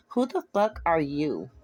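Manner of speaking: slow, defensive demand; guard up, challenge the stranger slowly. Acted. Girlfriend's ex challenges the unknown caller, defensive and slow. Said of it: "Her tone instantly becoming defensive".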